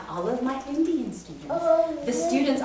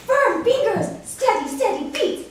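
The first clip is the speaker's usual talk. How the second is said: mock scream; 10 semitones higher than usual. Teacher imitating a student shouting piano advice. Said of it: "a student screams".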